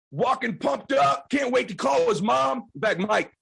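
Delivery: ordinary delivery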